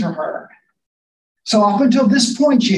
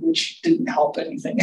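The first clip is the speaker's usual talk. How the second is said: breathy chuckle over the words; amused whispered aside with a laugh; 9 semitones higher, 6 dB quieter than usual. Real, not acted. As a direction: let the joke slip out on a laugh. Wry aside about the disappearing Jesus.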